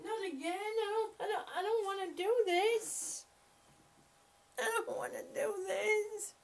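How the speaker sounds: high, whiny voice for the cat, laughing and half-crying; cat's voice, whining. Pretend. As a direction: whine like a reluctant cat begging mom. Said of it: "He's like".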